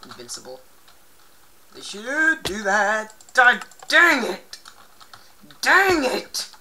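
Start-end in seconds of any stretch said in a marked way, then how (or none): 1.7-6.5 loud, higher dang it; frustrated outburst. Real, not acted. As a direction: yell it out after dying. Dies again, frustrated.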